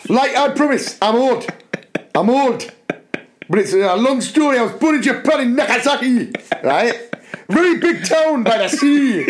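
put-on high, lilting Japanese-accented voice, a bit fast. Reading Kenzuki aloud to the class, in a high hammed-up Japanese accent. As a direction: read Kenzuki with a heavy comic Japanese accent, barely holding in laughter. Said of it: "this is now Kenzuki".

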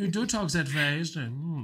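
hammed-up comic German accent. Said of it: "say you're doing a German accent".

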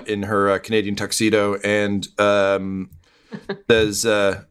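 usual speaking voice

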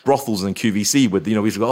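usual speaking voice

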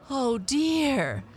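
high-pitched cry of dismay. A narrator's reading. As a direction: cry out high in dismay as a worried cat owner. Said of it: "cried Missus Thompson".

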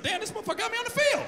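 high, fast shout of disbelief; amazed exclaim. Retold or imitated. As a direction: blurt it out, thrilled and shocked. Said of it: "I said".

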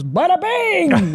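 high, slow drawn-out bada bing; mock catcaller calling after a woman. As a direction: stretch it out like a street catcaller. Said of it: "some guy just goes".

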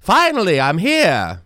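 high, loud, hammed-up jester greeting; heavenly jester. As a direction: announce yourself to God like a court fool. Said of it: "heavenly jester who's just gonna be like".

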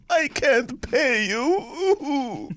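high, very slow mock-pitiful voice; mock whiny excuse. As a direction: drag it out high and pitiful, imitating her. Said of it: "she's like".

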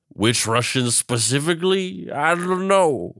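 mock-clueless drunk, pitch climbing; hammed-up drunken shrug; Woodrow Wilson as happy drunk. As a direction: drag out a high, helpless protest. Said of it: "your favorite happy drunk".

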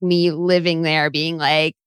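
normal talking voice

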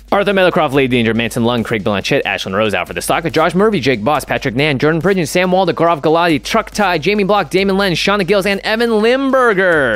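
rattling off names without breathing; rushing through the list on one breath; all in one breath. Pretend. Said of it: "You wanna try and do it in one breath?".

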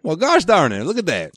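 high-pitched mock Southern twang; Southern accent. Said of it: "are you from the South?".

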